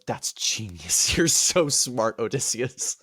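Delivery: slow, dopey admiration; awed crewman. Retold or imitated. One of his guys praising Odysseus, slow dopey awe. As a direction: gush slowly like a simple sidekick.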